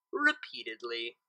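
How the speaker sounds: usual speaking voice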